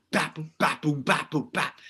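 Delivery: punchy mouthed drum pattern; beatbox a simple bap-boom groove; vocalized drum beat. Shown as a demonstration. Songwriter showing his garage drum idea, mouthed beat.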